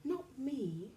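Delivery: soft hushed leopard; whispered. A narrator's reading. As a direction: say it hushed and secret. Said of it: "whispered the leopard".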